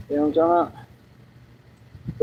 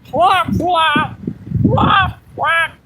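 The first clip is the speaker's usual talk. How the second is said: squeaky voice; 8 semitones higher, much slower than usual. Pretend. Showing off a silly voice, high squeal. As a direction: yelp it like a tiny bird.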